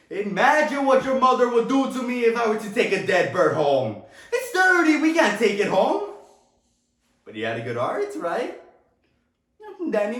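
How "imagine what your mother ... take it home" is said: high, amused fatherly protest; exasperated scolding. Acted. Father refusing to take a bird home, exasperated scolding. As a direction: protest with rising pitch and mock exasperation.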